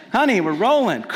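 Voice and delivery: hammed-up proud parent; cheering parent. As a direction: celebrate like you won.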